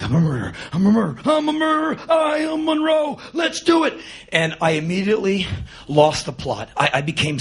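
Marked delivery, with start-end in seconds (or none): 0.0-3.9 hammed-up actor hyping himself, voice shooting upward; over-the-top psych-up. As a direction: work yourself into a frenzy, climbing to a high yell. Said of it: "I totally went over the top".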